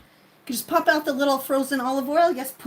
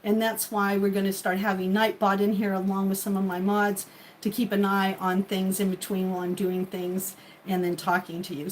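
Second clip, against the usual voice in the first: ordinary talk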